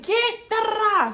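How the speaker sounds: high, loud yell for help; calling out. Retold or imitated. Yelling her sister's name from the wet grass, high and loud. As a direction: shout for your sister, high and urgent.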